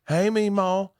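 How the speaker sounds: high-pitched Alabama drawl; exaggerated Deep South twang; Deep South accent. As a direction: mimic Southern family calling granny. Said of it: "around her in Alabama or in Georgia".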